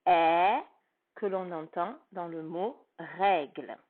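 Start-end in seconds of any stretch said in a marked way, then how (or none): 0.1-0.6 ẹ said alone as an open è, loud and held; isolated vowel sound. Shown as a demonstration. Teacher models the è sound of règle. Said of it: "on entendra le son".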